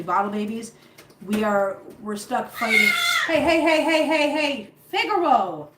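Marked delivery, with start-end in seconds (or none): 3.3-5.7 sharp loud calls, higher; calling out. Real, not acted. To Figaro the cat, loud sharp interrupting calls. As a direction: shout the cat off the kitten.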